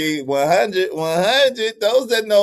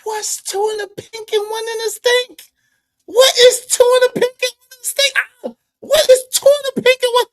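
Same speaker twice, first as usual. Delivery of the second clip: high-pitched, wailing disbelief; baffled outcry; 13 semitones higher, 4 dB louder than usual. Real, not acted. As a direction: demand an explanation way up high. Baffled by phrase in the clip.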